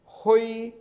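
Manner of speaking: हुई said high and loud, rising second tone. Shown as a demonstration. Teacher demos हुई to students, loud high rising tone. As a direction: call out हुई with a sharp rising tone. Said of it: "ऊंचे आवाज़ में बोला".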